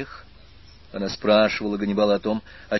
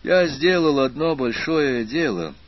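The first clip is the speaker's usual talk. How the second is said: slow, higher and louder report; unhurried announcement; 6 semitones higher, 5 dB louder, slower than usual. A narrator's reading. Aspirant tells Annabel by phone of his big deed, unhurried. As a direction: take your time announcing it, word by word. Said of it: "неторопливо сообщил аспирант".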